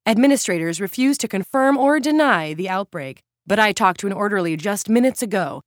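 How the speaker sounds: live on-location report; dramatic reporter delivery; TV field reporter. Acted.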